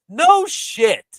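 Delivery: loud, high sarcastic retort; scornful outburst. Real, not acted. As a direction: blurt it out, exasperated at the obvious. Scornful retort to commentators' obvious remark.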